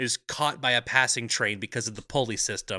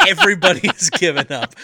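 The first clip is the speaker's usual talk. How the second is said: laughing; 5 semitones higher, 11 dB louder than usual. Real, not acted. Laughing through the words.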